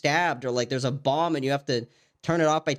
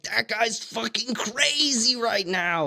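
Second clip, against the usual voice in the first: slow mock voice; 7 semitones higher, much slower than usual. Imitating onlookers.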